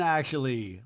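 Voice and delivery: ordinary delivery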